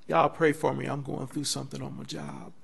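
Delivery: preacher mimicking a whiny testimony, hushed; mock testimony. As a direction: play the self-pitying member. Mimicking a church member's testimony.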